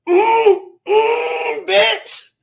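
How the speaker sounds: high moaning mm, then a punchline; food ecstasy. Retold or imitated. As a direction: moan with delight over the bite. Retelling Sean tasting pizza.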